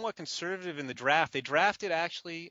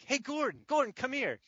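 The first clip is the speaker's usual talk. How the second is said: high-pitched, urgent summons; retold excited call across the office; calling someone over; 8 semitones higher than usual. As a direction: imitate beckoning a coworker to come listen.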